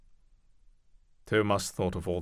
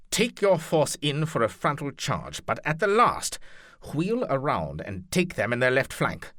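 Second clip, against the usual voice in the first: lordly commander, louder voice; commanding duke; 5 semitones higher, 5 dB louder than usual. Acted. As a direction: give the order like a duke. Leopold giving orders.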